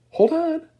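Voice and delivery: high, loud warning cry; cried out. A narrator's reading. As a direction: yell to brace them. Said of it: "cried Mister Wonka".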